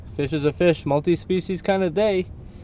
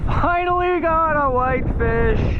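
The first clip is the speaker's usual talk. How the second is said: slow, high and drawn out with relief; excited; 9 semitones higher, slower than usual. Real, not acted. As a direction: stretch out "Finally" high, relieved and thrilled. Fish finally landed after hours.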